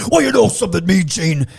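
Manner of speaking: hammed-up wrestler talking to the interviewer; wrestler interview promo. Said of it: "I would try and do all the voices".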